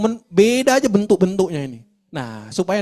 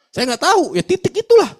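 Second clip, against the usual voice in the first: higher and louder, rising to a peak; animated retort; 11 semitones higher, 7 dB louder than usual. Retold or imitated. Preacher retells his answer to a parent, higher and louder. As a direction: toss the answer back with emphatic lift.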